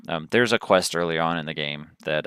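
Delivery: ordinary talk, nothing marked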